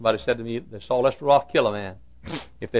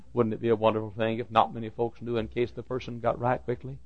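ordinary talk, nothing marked

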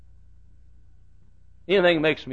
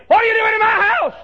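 high, loud indignant yell; outraged shout; 5 dB louder than usual. Pretend. Householder confronting dancers, shouting. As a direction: scream at the intruders. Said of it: "You would say".

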